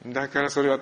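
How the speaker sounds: can't keep from laughing; real amused laughter. Real, not acted. Laughing at the misunderstanding.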